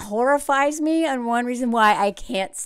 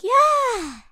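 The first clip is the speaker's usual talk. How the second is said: mock sweet yes; 12 semitones higher than usual. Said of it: "will look you dead in the eye and be like".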